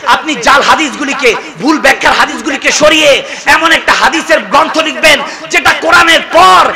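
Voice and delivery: high, loud, impassioned proclamation; thundering sermon delivery, high and forceful; full-force preaching. Acted.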